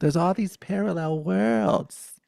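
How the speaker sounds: high, loud animated grandma; Italian granny. Retold or imitated. Grandmother telling grandchild about an article, high and loud. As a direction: announce it as an excited little granny. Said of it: "A little, little Italian granny Buddha".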